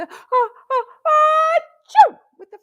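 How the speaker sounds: hammed-up big sneeze; mock sneeze. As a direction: act out a loud sneeze. Sneezing for the flu rhyme.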